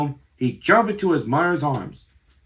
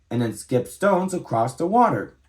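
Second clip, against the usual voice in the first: usual speaking voice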